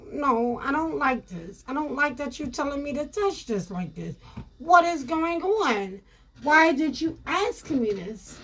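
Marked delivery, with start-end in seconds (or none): none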